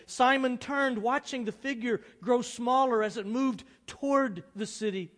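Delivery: loud, high, intense storytelling; dramatic story narration. A narrator's reading. Pastor reads a novel to the congregation, dramatic narration. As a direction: read it out with rising drama.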